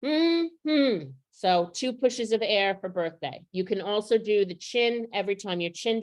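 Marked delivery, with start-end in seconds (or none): 0.0-1.1 two high muffled hums, closed-mouth; word shouted through pinched lips. Shown as a demonstration. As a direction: hum two syllables with lips pinched shut. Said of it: "I pinch my lips and I shout the word".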